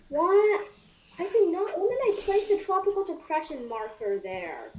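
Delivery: high, loud disbelief; shocked disbelief. Real, not acted. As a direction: cry out in surprise. Shocked at unexpected marker.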